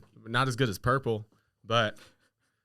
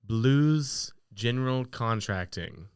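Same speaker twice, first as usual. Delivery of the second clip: very slow, each word spelled out; over enunciating; much slower than usual. Shown as a demonstration. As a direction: draw out every syllable of Blues General Contracting. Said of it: "So say it one more time slowly".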